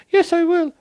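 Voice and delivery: very high, loud falsetto yes; hammed-up high-pitched acceptance; squeaky mock bride. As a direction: shriek the yes like a giddy bride. Voicing the accepting woman.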